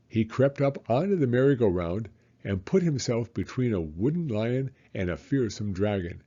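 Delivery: plain reading voice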